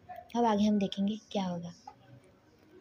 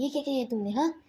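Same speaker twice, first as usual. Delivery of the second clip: ordinary delivery, nothing marked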